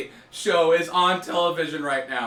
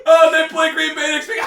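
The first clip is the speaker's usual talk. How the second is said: higher and louder, laughing through it; 10 semitones higher, 4 dB louder than usual. Real, not acted. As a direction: blurt it out, then crack up. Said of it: "I can't stop laughing".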